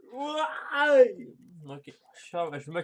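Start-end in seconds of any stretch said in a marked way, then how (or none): 0.1-1.3 long, high, loud wail; anguished wail. Acted. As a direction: howl "why" in mock despair. Wailing over the bad ranking.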